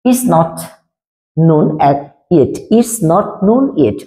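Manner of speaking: slow, careful, every word separate; slow model sentence. Shown as a demonstration. Teacher slowly dictates the English version to students. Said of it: "এখন ইংলিশটা দিব yet".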